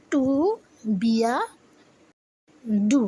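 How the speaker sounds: "টু বিয়া ডু" broken by long pauses; pronunciation demo. Shown as a demonstration. Teacher dictating to learners, "টু বিয়া ডু" in three separated pieces. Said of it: "এইটার উচ্চারণ হচ্ছে".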